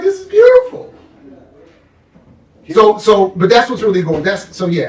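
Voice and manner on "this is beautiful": high, loud sarcastic exclamation; mock delight. Praising the villains' plot with mock delight. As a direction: gush with fake delight. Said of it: "I was like".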